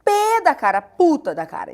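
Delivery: loud burst starting high, then dropping; mock outrage. Reader misreading a kind message, hammed-up anger. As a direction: exaggerate being pissed off on reading it. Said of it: "eu já leio".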